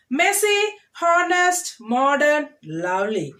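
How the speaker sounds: Messy, honest, modern, lovely, each stressed at the start; first-syllable stress. Shown as a demonstration. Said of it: "stress the first syllable".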